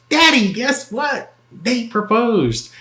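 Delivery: high, squeaky mock of an excited young bride; giddy daughter voice. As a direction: play her as a giddy girl calling mommy. Imitating wife calling her dad.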